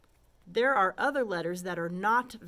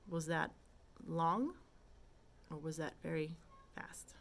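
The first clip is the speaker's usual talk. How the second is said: ordinary delivery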